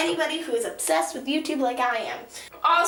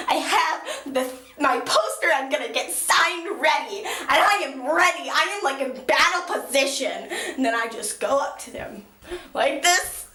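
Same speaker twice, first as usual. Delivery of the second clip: ordinary talk, nothing marked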